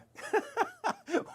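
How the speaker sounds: high-pitched burst of giggling; real high laugh at own joke; chuckle. Real, not acted. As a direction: crack up mid-sentence. Cracks up at own dog joke.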